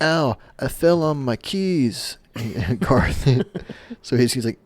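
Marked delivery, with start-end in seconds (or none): 0.0-2.2 higher, slow mock whine. Crawling in the vent, mock whining in pain. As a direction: whimper about the keys.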